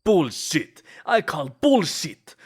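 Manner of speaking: loud, high indignant yell, slowing on the second; outraged shout. Acted. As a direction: bellow it like you've been insulted. Outraged at rival's cuddling boast.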